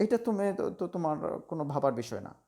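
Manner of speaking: usual speaking voice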